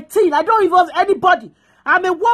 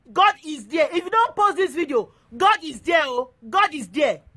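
ordinary talk

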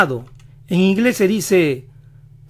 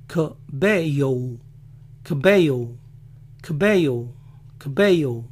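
American accent; 2 semitones lower than usual. Shown as a demonstration. Showing how Americans say [name], American accent. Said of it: "El apellido Cabello lo dicen".

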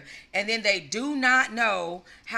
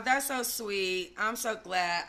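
ordinary talk, nothing marked

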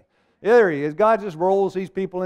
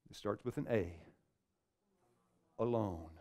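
softer, lower, sombre; hushed reveal; 6 semitones lower, 14 dB quieter than usual. Acted. Preacher quietly revealing the word, much softer. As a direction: drop the voice for the answer.

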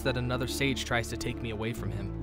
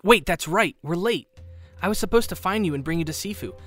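higher, louder, hurried remembering; sudden urgent realisation; 6 semitones higher, 5 dB louder than usual. Acted. Friend remembering to bring Kyoshi to Sifu, urgent. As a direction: jolt into action, talking quickly.

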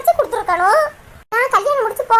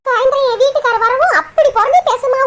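ordinary delivery

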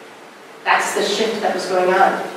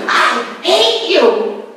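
drawn-out mock yell, slow and pitched up; retold yell; 6 semitones higher, much slower than usual. Woman in Belize yelling at the speaker, mock yell. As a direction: replay a stranger's outburst, half-acted. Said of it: "she viscerally yelled at me".

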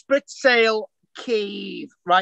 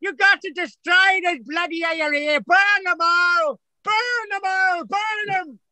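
Paisley shouting impression; 8 semitones higher than usual. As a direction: rant like a fire-and-brimstone Ulster preacher. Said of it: "Did you ever meet Ian Paisley".

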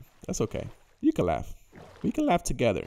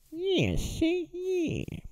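much higher, thin squeak; squeaky voice; 9 dB quieter, much slower than usual. Pretend. Joking to viewers after the fall, squeaky. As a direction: pitch way up into a squeak.

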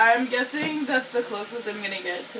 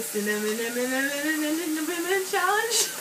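cinnamon repeated, climbing higher each time; stuttered rising cinnamon; 5 semitones higher than usual. Shown as a demonstration. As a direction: stammer cinnamon over and over, pitch rising. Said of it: "At least that's how I used to say it".

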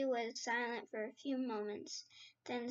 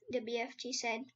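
ordinary delivery, nothing marked

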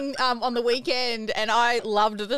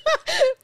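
burst of laughter; 4 dB louder than usual. Real, not acted. Laughing at odd warm-up noise.

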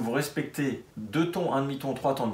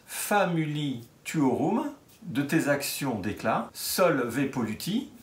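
very slow, word by word, so the class can follow along; slow recitation; slower than usual. Shown as a demonstration. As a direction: spell out the old hymn slowly, line by line. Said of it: "que vous allez suivre avec moi pendant que je vous le dis". (lecture très lente, mot à mot)

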